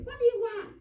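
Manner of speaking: high, loud mock snap; cranky. Gary's wife answering the door, cranky and loud. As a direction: imitate an irritable, high-pitched demand.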